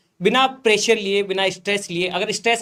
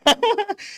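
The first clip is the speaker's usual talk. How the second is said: high bashful laugh; 13 semitones higher than usual. Real, not acted. Laughs after praise.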